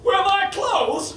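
high, slow, odd-sounding plea; confused man asking for his clothes, high and slow; put-on voice. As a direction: whine it out, high and drawn. Retold punchline voice.

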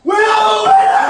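high, loud victory yell; victory shout. Real, not acted. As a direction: shout the win to everyone. Celebrating win.

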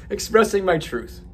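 "truth" said right through a laugh; amused correct take; laughing correction. Real, not acted. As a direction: fix it while still laughing.

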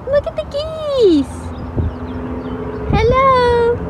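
high sing-song cooing voice; cooing at geese. Real, not acted. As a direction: coo like to a pet.

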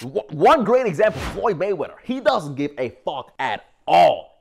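usual speaking voice

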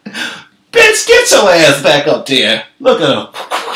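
high, loud mock shout; crowd yell. Crowd yelling at [name] to go back up. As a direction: yell it up at her. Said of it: "the crowd is like".

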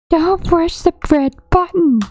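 high, far-off silly warning voice; squeaky cautionary voice; mock warning. Playing with a friend, mock warning before pressing. Warning about red button.

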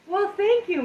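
touched and surprised thanks; delighted thanks. Real, not acted. Reacting to viewer compliment.

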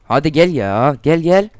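loud, rising taunt to come fight; taunting shout. Real, not acted. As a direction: gamer calling out a rival. Taunting opponent during a fight.

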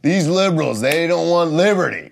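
high, loud indignant rant; mock outraged conservative. Comic mimics conservatives ranting about liberals, high and loud. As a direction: blast it like an outraged pundit. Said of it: "Everybody's like".

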